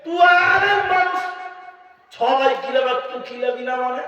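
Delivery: enraged uncle yelling at the stubborn son; angry shout. Acted. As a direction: bark it at him, outraged. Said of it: "চাচা রেগে গিয়ে বলছে".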